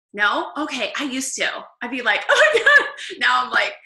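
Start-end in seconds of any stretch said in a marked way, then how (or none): 2.3-2.9 high, loud mock wail of "oh my God"; mock dramatic outburst. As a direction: play up the overreaction in a high, loud voice. Acting out past reaction to sadness.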